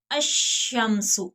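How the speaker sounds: अशम्सु slowly, doubled sh, no l; assimilated lam. Shown as a demonstration. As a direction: stretch अशम्सु as ash-shamsu. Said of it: "अब दूसरी मिसाल देख लें". (अशम्सु धीरे, शीन पर तशदीद)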